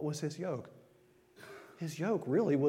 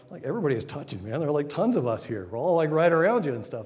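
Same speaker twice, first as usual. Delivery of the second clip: disciples protesting; 9 dB louder, faster than usual. Retold or imitated. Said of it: "the disciples are like".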